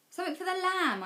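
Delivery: exclaiming too loudly. A narrator's reading. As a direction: blurt it out as the idea hits. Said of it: "I exclaim far too loudly".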